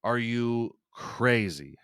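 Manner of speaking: ordinary talk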